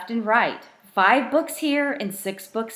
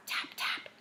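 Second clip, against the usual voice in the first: whispered tap tap; 15 dB quieter than usual. A narrator's reading. Knocking on the window.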